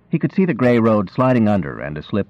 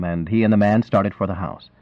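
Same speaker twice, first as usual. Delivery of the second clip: plain reading voice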